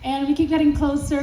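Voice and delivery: ordinary delivery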